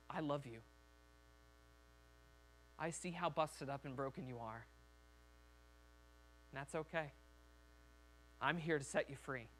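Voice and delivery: soft, low, tender reassurance; quiet fatherly acceptance; loving father. Acted. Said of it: "Comes at us like a father".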